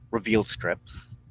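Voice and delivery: usual speaking voice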